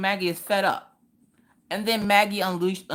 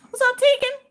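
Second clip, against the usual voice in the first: cheery greeting; 5 dB louder than usual. Real, not acted. Greeting live chat viewer.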